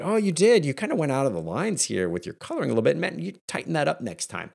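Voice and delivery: high-pitched, mock-polite nitpicking; imitating a parent critiquing a child's drawing. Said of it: "And instead I critique it".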